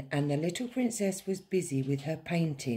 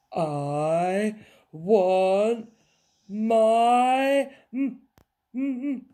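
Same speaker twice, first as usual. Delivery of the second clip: loud, rising whine that breaks off; faltering wail; 5 semitones higher, 7 dB louder, much slower than usual. A narrator's reading. Princess left at castle, wail petering out. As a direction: start the wail, then stall on the m.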